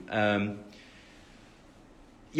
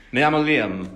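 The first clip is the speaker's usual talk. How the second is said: loud Spanish with an Italian lilt; pretend Spanish, slightly Italian; 8 dB louder than usual. Admits not knowing Spanish, pretend Spanish sounding Italian. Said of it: "Why does it sound slightly Italian?".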